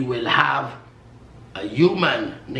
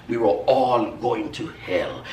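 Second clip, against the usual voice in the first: ordinary talk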